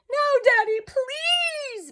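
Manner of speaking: put-on very high child voices, pleading; begging children. A narrator's reading. Children begging Father to keep Walter. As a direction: plead with Daddy like small kids. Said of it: "begged Betty and Billy".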